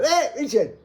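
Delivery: high loud shouted greeting; high cheery mock shout. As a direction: call it out across the car park. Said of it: "they all go".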